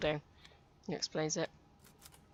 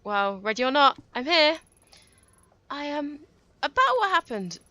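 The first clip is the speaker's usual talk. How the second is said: much higher, louder chatty coworker voice; gossipy Saki; 8 semitones higher, 11 dB louder than usual. Acted. Coworker Saki greeting Ito back at work, high gossipy voice. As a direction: gush like the office gossip. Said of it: "she's gossipy".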